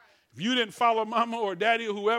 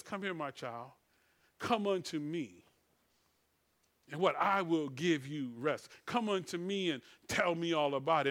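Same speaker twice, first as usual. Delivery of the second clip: tender invitation; 5 semitones lower, 4 dB quieter than usual. Acted. God calling his child to rest, tender and hushed. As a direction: draw a child in gently.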